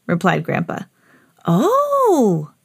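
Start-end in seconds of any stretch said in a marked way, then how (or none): 1.4-2.5 high, long oh; little pig's excited exclamation; excited gasp. A narrator's reading. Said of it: "gasped Peppa excitedly".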